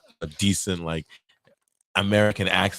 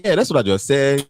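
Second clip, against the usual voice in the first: put-on higher, insistent Nigerian-accented customer; lilting mock Nigerian accent; 6 semitones higher, 4 dB louder than usual. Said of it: "Why do you have to sound like this?".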